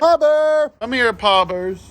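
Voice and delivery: high, loud call across the room. Real, not acted. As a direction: call him in from far off. Calling the capybara in.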